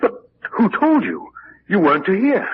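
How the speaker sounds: taken aback, demand to know; alarmed. Acted. Uncle alarmed his niece heard of the death, high and urgent.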